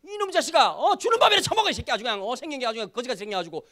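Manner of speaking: loud, high outburst tailing into rapid grumbling; mock-berate an ungrateful youngster, hardest at the start; acted scolding. Pastor playing the chief official scolding Daniel over food, mock-angry. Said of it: "이렇게 야단을 쳐야 되는데".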